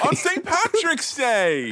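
high, slow delighted exclamation; excited echo. Real, not acted. Crowing back at his co-host with high excitement. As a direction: pounce on it with glee.

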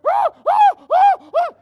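high, loud, shrieking imitation of a frightened scream; loud, high fake shriek; imitated scream. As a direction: mimic his wife's terrified screaming. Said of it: "She started screaming".

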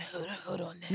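low, soft impersonation; softly put-on man's voice; imagined celebrity voice. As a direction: play him quiet and low. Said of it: "the way I think he sound".

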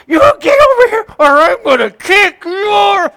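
furious mother yelling at her son in a store; angry screaming. Retold or imitated. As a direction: bellow at the kid in front of everyone. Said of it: "screaming this".

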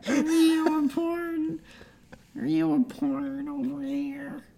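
high slow creaky voice; squeaky old voice. Pretend. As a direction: croak like a confused elder.

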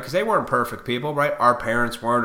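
ordinary talk, nothing marked

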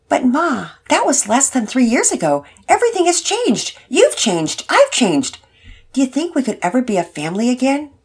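put-on a hopeful kid pleading with his mom; teen son.

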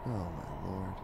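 ordinary delivery

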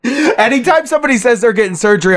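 quick and high, laughing. Real, not acted. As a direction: rush it out while laughing. Laughing at his own habit.

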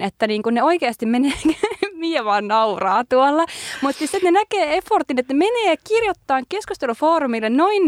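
high-pitched, incredulous outrage; baffled and half amused, voice riding high; appalled disbelief. Real, not acted. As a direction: react aghast, pitch jumping up on the key words. Appalled reaction to the posts just read.

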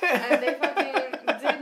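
loud, slow, laughing disbelief; recalling the neighbours hiding, laughing. Real, not acted. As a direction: stretch it out laughing.

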